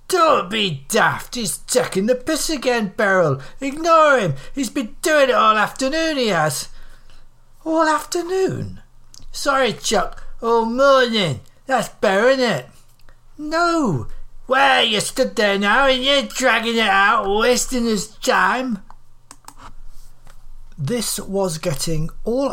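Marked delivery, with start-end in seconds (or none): 0.1-8.8 high, loud northern scolding; indignant old women. Acted. Old woman tells Beryl he's taking the piss, indignant. 9.3-18.8 high, loud northern nagging; bickering old women. Acted. Old women argue and accuse each other of dragging it out, bickering.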